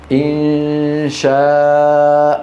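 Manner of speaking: إن شاء with the long alif held slowly; slow madd. Shown as a demonstration. Brother asking whether this is madd badal, slowly. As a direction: draw out إن شاء as the questioner. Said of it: "بيقول لك".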